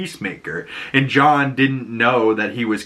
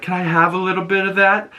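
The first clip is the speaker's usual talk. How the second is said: higher, quick, lightly voiced lech; sleazy party guy; 7 semitones higher, faster than usual. Hungover orgy guest asking Peacemaker, quick and higher. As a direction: toss it off like a cheeky orgy guest. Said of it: "one of the guys is like".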